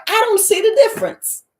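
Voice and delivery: high, loud comic confusion; baffled squeal. Pretend. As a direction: squeak it out in disbelief. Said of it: "You'd be like".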